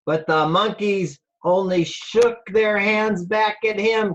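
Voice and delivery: usual speaking voice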